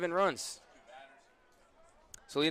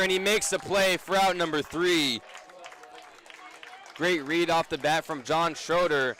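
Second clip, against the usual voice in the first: raised, louder baseball call building on the catch; punchy radio call of a fly-out; live play-by-play; 3 semitones higher, 4 dB louder than usual. Real, not acted. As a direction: call the out with rising energy.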